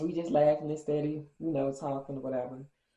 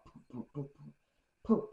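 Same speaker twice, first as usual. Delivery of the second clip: soft sputtering putt-putt of a dying car engine; sputtering engine noise; 7 dB quieter, slower than usual. Retold or imitated. Describing the car breaking down to friends, mimicked sputter. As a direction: mimic an engine coughing out, quietly. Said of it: "making this putting sound, like".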